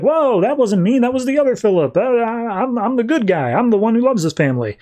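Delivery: high, hurried mock self-defence; flustered protest. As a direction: act out a startled man pleading innocence. Accused man protesting his innocence.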